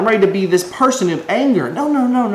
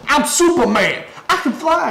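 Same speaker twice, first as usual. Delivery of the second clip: laughing boast, pitch jumping higher; mock boast; 8 semitones higher than usual. Pretending to claim he's Superman, laughing boast. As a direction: brag it like a joke you can't keep straight. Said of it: "if I walked out and I said".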